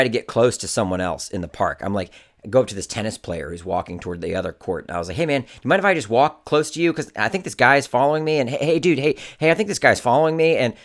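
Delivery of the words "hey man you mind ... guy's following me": raised, urgent approach to a stranger; mildly panicked, stumbling over the heys; anxious plea. Retold or imitated. Man asking tennis player to walk close, anxious plea.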